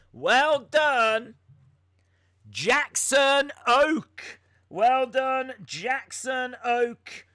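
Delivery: high, loud, drawn-out well done; excited congratulations. Real, not acted. Host cheering the chat winner, loud and excited. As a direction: cheer the winner's name out slowly.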